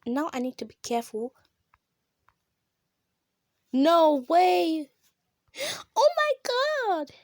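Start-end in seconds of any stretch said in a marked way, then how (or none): 5.5-7.1 much higher, gasped exclamation; gasping oh my god. Real, not acted. Player gasping at a surprise in the game, shrieking. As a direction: gasp and squeal it.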